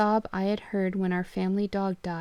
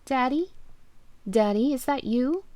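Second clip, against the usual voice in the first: put-on higher, small child's voice, hopeful; a lost daughter calling her dad; little girl; 5 semitones higher, 4 dB louder than usual. Lost daughter on the phone asking for her father.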